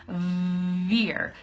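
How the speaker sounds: "veer" with a long buzzing V; exaggerated "vvv-veer"; vibrating V. Shown as a demonstration. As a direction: hold the V of "veer" before the vowel. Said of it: "your teeth vibrate against your bottom lip".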